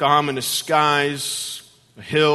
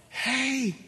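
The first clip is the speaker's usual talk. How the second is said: high-pitched imitation of a woman; imitated woman calling out from behind; female voice; 9 semitones higher than usual. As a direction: put on a high lady's voice. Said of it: "female voice said".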